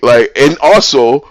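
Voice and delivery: normal talking voice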